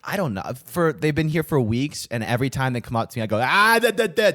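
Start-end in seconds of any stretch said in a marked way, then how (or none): 3.4-4.3 loud, high wave-off babble; mock shooing off. To street canvassers coming up to him, loud shooing off. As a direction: cut them off with loud, high nonsense syllables. Waving off people who approach him.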